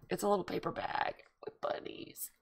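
high breathy hush of delight; a tender, breathless gush over bunnies. Real, not acted. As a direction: hush it like a thrilled secret. Delighted over bunny package.

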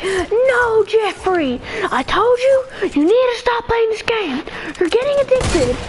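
high-pitched mock mother voice; scolding mom. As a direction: play an exasperated mom lecturing her son. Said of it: "I'm the mom".

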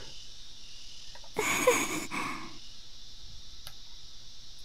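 airy snicker barely voiced; a laugh held back behind the teeth; breathy stifled laugh. Real, not acted. Girl laughing at her own sarcasm, stifled laugh.

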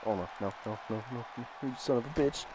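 very low, soft grumbling; dejected muttering. Real, not acted. Gamer seeing things go wrong, low dejected mutter. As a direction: mutter it far down under your breath.

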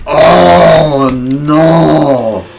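loud high "oh no" yell; alarmed cry. Real, not acted. Gamer yelling as his character dies, loud alarmed cry. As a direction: yelp as it goes wrong. Another death in game.

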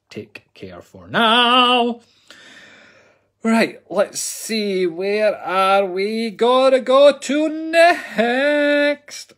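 high, very slow, drawn-out singsong; playful stretched-out hosting voice; drawn-out sing-song. Acted. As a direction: stretch every word out up high.

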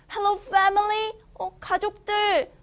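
high, calling out for family; lost dog calling. Acted. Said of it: "더그가 놀랬네요".

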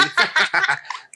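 a high burst of laughter; real high laugh; hearty laugh. Real, not acted. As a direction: laugh at the memory.

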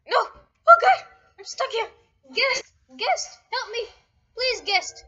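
high, loud cries for help; panicked calling out. Real, not acted. Player stuck in the game calls to Guest, panicked. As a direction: shout urgently for a rescuer.

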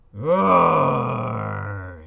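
a big, loud roaring lion; lion's roar. Acted. Teacher reading to kids, roaring loud like a lion. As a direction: roar it out like the king of the zoo. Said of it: "says the lion".

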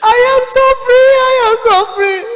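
high, loud, sobbing wail; sobbing cry. Retold or imitated. Dead pastor wailing to Linda from hell, sobbing. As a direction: cry out in torment.

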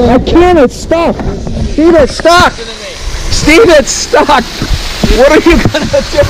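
high, loud panicked yelling; yelling to Steve that the boat is stuck, high and panicked; panicked shout. Real, not acted. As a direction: shriek it like the boat won't budge.

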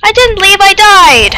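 high, loud whine; indignant protest. Real, not acted. Player accused of leaving by friend, indignant protest. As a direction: protest loudly, stretching the last word.

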